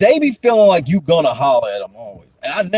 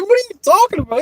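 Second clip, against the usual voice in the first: baffled question; faster than usual. Retold or imitated. Confused reply, laughing.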